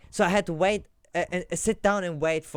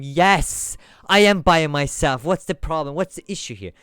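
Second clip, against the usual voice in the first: louder, exasperated yes I am by myself; irritated retort; 2 semitones higher, 9 dB louder than usual. Retold or imitated. Traveller retorting to the third officer, exasperated. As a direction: snap back at the officer, fed up. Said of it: "I didn't say it that hard".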